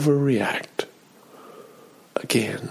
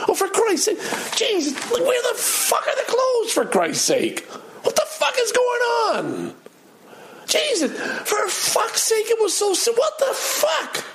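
furious swearing, pitched far higher; shouting and swearing. Retold or imitated. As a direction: re-enact the full-blown tantrum over missing laundry. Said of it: "I'm shouting and swearing".

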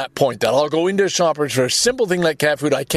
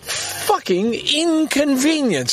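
slow, high-pitched exasperated rant; pissed off; 5 semitones higher, slower than usual. Real, not acted. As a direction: stretch the key words in real indignation. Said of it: "I'm just so pissed at that".

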